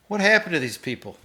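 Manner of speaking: ordinary talk, nothing marked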